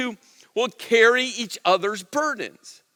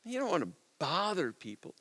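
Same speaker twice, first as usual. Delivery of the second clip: hushed, insinuating gorilla voice; whispering; 12 dB quieter than usual. Acted. Gorilla in the mind whispers discouragement to you, whispering. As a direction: drop to a soft, sly near-whisper. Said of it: "the eight hundred pound gorilla whispers to you".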